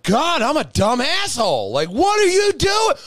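high, loud mock scolding of himself; mock self-scolding. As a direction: berate yourself in disbelief. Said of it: "I'm just like".

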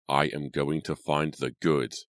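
slow, through gritted teeth; slowly, teeth clenched. Acted. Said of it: "he said slowly through gritted teeth".

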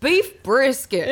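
slow, drawn-out Texas drawl; Texas accent. Retold or imitated. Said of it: "Texas accent".

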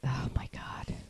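whispered, worn-out dismay; barely voiced groan of disbelief; exasperated whisper. Real, not acted. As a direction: say it under your breath, defeated. Exasperated after the long quote.

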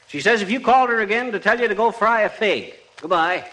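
normal talking voice

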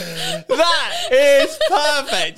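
high, helpless laughing speech; words dissolving into laughs; crying with laughter. Real, not acted. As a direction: squeal it slowly through real laughter. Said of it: "I'm crying already".

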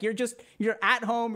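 normal talking voice

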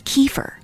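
"kefir" as KEE-fer, stress on the first syllable; common US pronunciation. Shown as a demonstration. Teacher giving the often-heard American version, KEE-fer "kefir". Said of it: "the most often heard pronunciation is kefir".

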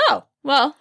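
ordinary delivery

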